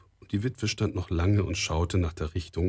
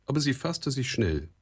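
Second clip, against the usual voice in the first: plain reading voice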